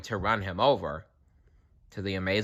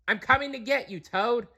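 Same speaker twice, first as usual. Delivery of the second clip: calling out; 3 dB quieter, faster than usual. A narrator's reading. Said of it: "called Fox".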